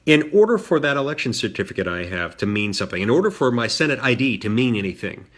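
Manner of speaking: ordinary talk, nothing marked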